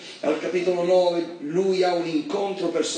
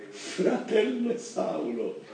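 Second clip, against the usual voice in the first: softer, slow and drawn out; slow tender greeting; 3 semitones higher, 5 dB quieter, much slower than usual. Acted. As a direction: call him brother gently, reaching out. Anania greets Saul.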